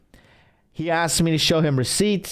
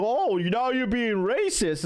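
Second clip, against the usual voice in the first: higher and slower, mocking the guy as a crybaby; a slow, higher-pitched crybaby parody; 6 semitones higher than usual. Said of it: "He's like".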